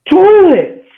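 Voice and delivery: very high, loud, drawn-out mock yell. Angry sister from the doorway, imitated yelling. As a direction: holler the word in a stretched, screechy imitation. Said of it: "they're yelling back and forth".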